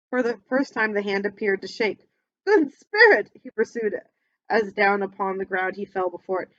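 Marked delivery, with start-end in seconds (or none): 2.4-3.3 loud, high anguished no; desperate pleading. Acted. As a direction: shout your denial in terror. Scrooge begs at the grave.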